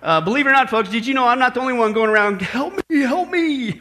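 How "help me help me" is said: high, drawn-out comic plea for help; mock crying out. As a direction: cry out in hammed-up distress. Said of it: "literally crying out".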